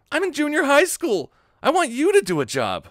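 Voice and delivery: high, loud outburst; indignant protest. Acted. Schoolboy reacting to talk of a job, loud protest.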